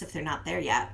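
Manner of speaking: normal talking voice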